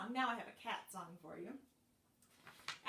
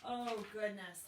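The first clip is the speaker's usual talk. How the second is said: normal talking voice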